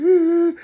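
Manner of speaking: squealing laugh; high burst of laughter. Real, not acted. Storyteller cracks up at the memory, high squealing laugh.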